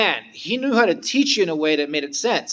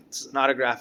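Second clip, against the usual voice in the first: normal talking voice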